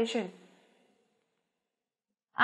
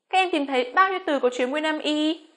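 usual speaking voice

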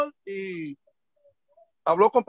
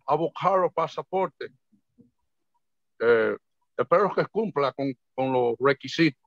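imitating the consul's way of talking; 7 semitones lower than usual. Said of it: "Pues hablaba así".

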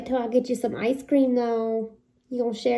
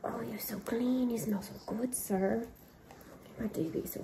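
soft whisper to the dog; 11 dB quieter than usual. Real, not acted. Owner whispering praise to her clean fluffy dog, whispered. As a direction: whisper sweet nothings to your freshly bathed pup. Cooing to freshly bathed dog.

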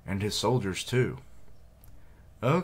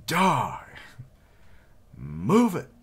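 loud, high barked commands; battle shout; 9 semitones higher, 8 dB louder, much slower than usual. Acted. Soldier yelling at the enemy, loud and high. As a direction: yell at the enemy mid-charge.

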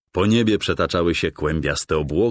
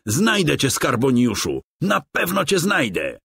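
much higher, determined shout; defiant cry; 10 semitones higher than usual. A narrator's reading. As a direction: call after the vanishing villain. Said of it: "wykrzyknął Tymoteusz".

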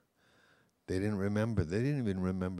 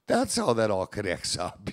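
gasp, then a louder burst of realization; re-enacted thrilled revelation; imitated excitement; 3 semitones higher, 6 dB louder than usual. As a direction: gasp and blurt it out as if it all clicks. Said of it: "she was so excited to go".

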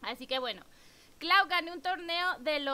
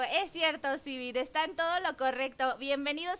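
ordinary talk

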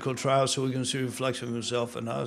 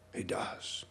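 a hushed whisper for emphasis; 14 dB quieter than usual. Real, not acted. Preacher whispering to the congregation for emphasis. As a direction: drop to a breathy secret.

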